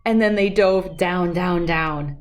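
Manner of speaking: plain reading voice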